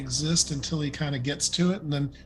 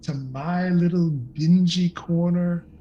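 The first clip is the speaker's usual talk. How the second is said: a hint of a queen showing off her lair; regal mistress; 2 semitones higher, 3 dB louder than usual. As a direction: welcome guests grandly, stretching the words. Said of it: "She says".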